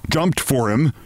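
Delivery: ordinary delivery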